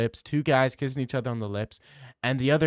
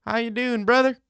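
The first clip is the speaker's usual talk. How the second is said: much higher, louder at the end; high mock greeting; 12 semitones higher, 6 dB louder than usual. Greeting the chat in a high put-on voice. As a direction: squeak it out with a jokey rise.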